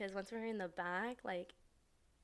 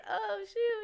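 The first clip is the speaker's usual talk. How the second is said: high, louder surprised exclamation; mock surprise; 13 semitones higher, 6 dB louder than usual. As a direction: imitate a startled reaction, pitched way up. Surprised reaction to long hug, retold.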